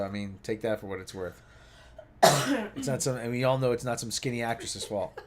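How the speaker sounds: normal talking voice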